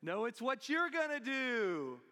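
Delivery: loud called-down answer; acted loud reply from far above. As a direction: call down from on high, drawing out the end. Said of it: "And the voice said".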